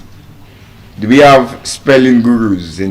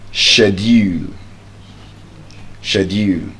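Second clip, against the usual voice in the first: British schedule; 4 semitones lower, 6 dB quieter than usual. Shown as a demonstration. Teacher demonstrates the British pronunciation. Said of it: "the British pronunciation of that word".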